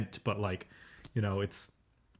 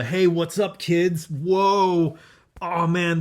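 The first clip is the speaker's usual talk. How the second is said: higher, hammed-up hip greeting; pitched-up fake-cool enthusiasm; mock catchphrase voice; 5 semitones higher, 5 dB louder, much slower than usual. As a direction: parody a try-hard appeal to kids. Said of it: "trying to make its own catchphrases".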